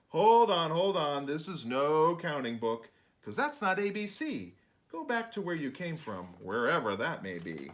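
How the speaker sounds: mock stern scolding; exasperated narrator. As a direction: shoo them out of the book. Narrator scolds intruding creatures.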